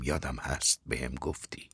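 very soft, subdued reply; quiet, fading admission; softly calm. A narrator's reading. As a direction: answer gently, under your voice. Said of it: "به آرامی گفتم".

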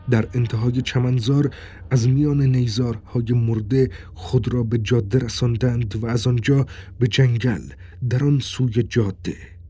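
usual speaking voice

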